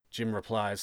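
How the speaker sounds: plain reading voice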